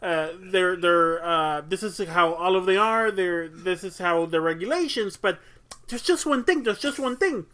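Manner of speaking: hesitant, repetitive sleuth circling the puzzle; bad Columbo. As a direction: stammer through it like a politely nagging detective. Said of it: "My bad Columbo impression".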